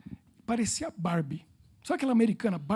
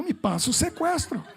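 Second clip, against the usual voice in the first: louder, higher, laughing; laughing blunder; 7 semitones higher, 4 dB louder, slower than usual. Retold or imitated. He repeats his blunder to the woman, laughing. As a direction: say it cracking up.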